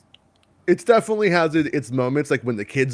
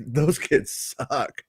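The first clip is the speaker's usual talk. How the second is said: slow, softer, stretched-out SUCK; drawn-out verdict; 6 dB quieter, much slower than usual. Retold or imitated. Parent judging other kids at Disneyland, slow muted verdict. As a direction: drag it out under your voice.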